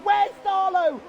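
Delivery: high, loud crash yell; shocked shout. Real, not acted. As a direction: scream it out in disbelief. Big crash called live.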